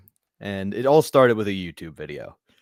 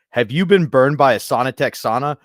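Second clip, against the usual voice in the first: punchy, raised mock commercial pitch; lawyer ad; 3 semitones higher, 4 dB louder than usual. Lawyer ad offers to sue Saunatech, mock announcer pitch. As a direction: sell it like an injury-lawyer commercial. Imitates lawsuit ad.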